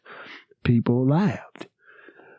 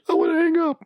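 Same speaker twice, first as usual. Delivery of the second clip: panicked thought; faster than usual. Retold or imitated.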